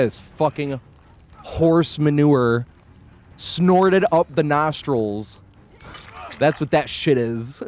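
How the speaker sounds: every word stretched very slowly for emphasis; drawn-out rant. Real, not acted. Streamer ranting to chat about scans, slow and drawn out. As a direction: drag each word out like it disgusts you.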